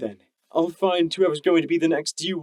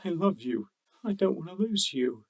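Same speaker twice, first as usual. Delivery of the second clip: softer, hushed declaration; whispered; 9 dB quieter than usual. A narrator's reading. Stallion telling his lover he loves him, softly. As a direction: breathe it close and quiet. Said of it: "P twenty-one whispered".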